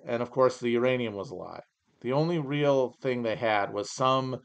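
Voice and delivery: normal talking voice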